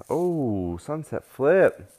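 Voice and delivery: loud, high, stretched out move call; excited call. Real, not acted. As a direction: shout the move out.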